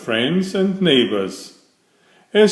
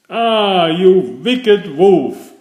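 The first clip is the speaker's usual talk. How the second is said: higher and louder, slow angry cry; indignant exclamation; 6 semitones higher, 5 dB louder, slower than usual. A narrator's reading. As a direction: woodcutter bursts in and shouts at the wolf. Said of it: "the woodcutter exclaimed".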